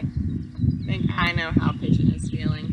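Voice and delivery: plain reading voice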